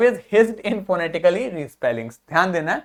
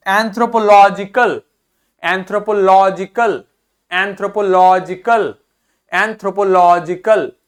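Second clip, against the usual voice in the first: Anthropological with लॉ punched out; stress on लॉ; 4 dB louder than usual. Shown as a demonstration. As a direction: drill anthropological, weight on लॉ. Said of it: "ज्यादा जोर हम देंगे".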